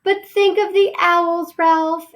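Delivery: high, slow, pleading plea; imploring mother mouse. Acted. Mother mouse implores Ralph about the owls, high and pleading. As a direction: beg him with a high, anxious voice. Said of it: "implored his mother".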